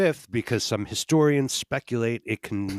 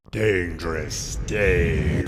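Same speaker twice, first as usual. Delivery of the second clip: slow, deep, drawn-out name call; slow announcer callout; 5 semitones lower, 4 dB louder, much slower than usual. Acted. Announcer drawing out the cohost's name, slow and loud. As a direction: stretch each word like a ring announcer.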